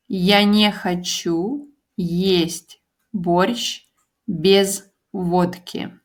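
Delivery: "Я не хочу есть борщ без водки" said slowly, word by word; slow model sentence. Shown as a demonstration. Teacher modelling the sentence slowly for learners. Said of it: "I don't want to eat борщ without vodka".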